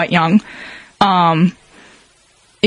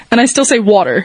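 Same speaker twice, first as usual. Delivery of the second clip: ordinary talk, nothing marked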